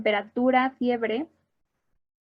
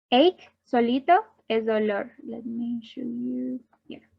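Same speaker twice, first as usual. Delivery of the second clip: ordinary talk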